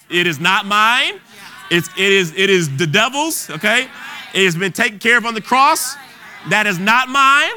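rising fervour, hammer each claim home; preaching at full force. Acted. Preacher declaring healing to the congregation, full force. Declares victory over sickness.

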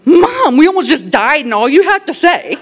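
high, loud, laughing incredulous son; mock the absurdity while cracking up; quoting son through laughter. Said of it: "he goes".

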